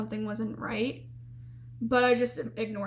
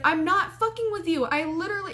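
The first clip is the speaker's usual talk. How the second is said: higher-pitched retold protest, mad; getting mad; 6 semitones higher, 3 dB louder than usual. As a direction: imitate an indignant roommate pushing back, pitched up. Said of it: "she's getting mad".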